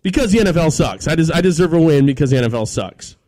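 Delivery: loud, high, fed-up complaining; angry rant. Real, not acted. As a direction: vent it loudly at the league. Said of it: "That's, that's my rant".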